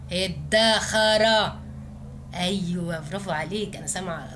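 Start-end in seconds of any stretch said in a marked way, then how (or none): 0.1-1.5 ادخر stretched very slowly, every sound held; slow drawn-out answer. Shown as a demonstration. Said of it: "مين الشاطر اللي هيعرفها". (نطق ادخر ممدود ببطء شديد)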